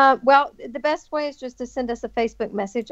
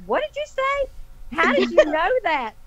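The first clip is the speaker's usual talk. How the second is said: stunned disbelief; 7 semitones higher, slower than usual. Retold or imitated. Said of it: "I was so stunned".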